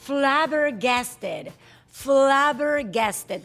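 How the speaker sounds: Flabbergasted said very slowly, every syllable drawn out; slow pronunciation. Shown as a demonstration. Host carefully pronouncing Flabbergasted for the guest, very slow. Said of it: "¿cómo pronunciamos esto?".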